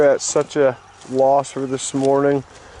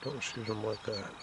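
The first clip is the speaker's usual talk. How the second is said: hushed hunter's whisper; wildlife watching whisper; 3 semitones lower, 21 dB quieter than usual. Real, not acted. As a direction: keep it low so the bird won't hear. Whispering while hunting a bird.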